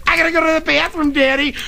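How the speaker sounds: put-on high-pitched kid calling from back seat; whine-yell it up high like a child; high, hurried kid's yell. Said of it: "the kids are yelling from the back seat".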